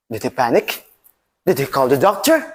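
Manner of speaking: plain reading voice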